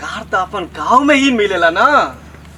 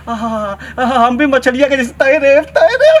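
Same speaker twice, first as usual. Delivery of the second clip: rising into a high, loud laugh; gleeful fantasy; 4 semitones higher, 3 dB louder than usual. Acted. Villager imagines swimming like a fish, rising giggly glee. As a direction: build from delight to squealing laughter.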